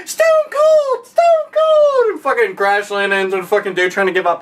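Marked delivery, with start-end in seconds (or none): 0.1-2.1 put-on much higher, shrill kid chant; imitating kids chanting the wrestler's name; screaming kids. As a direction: scream the name like an overexcited child. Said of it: "And kids like".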